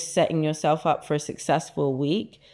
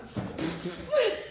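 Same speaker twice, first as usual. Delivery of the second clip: high laugh; 7 dB quieter than usual. Real, not acted. Sinking into new sofa.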